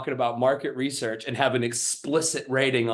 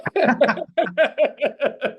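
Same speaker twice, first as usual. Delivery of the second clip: loud laugh; 13 semitones higher, 4 dB louder than usual. Real, not acted. Laughing after impression.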